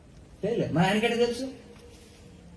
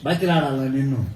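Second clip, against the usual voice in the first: ordinary delivery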